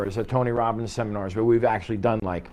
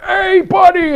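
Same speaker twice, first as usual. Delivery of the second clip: very high, loud hammed-up holler; mock hearty greeting; 10 dB louder, slower than usual. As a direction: imitate someone greeting far too loudly. Imitates overbearing greeter.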